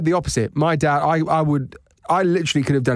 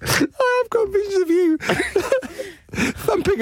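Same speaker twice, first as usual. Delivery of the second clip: laughing; slower than usual. Real, not acted. Laughing at roof jumps.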